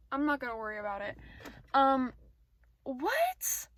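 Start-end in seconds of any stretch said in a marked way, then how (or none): 2.8-3.7 startled what. Real, not acted. Reacting to something outside.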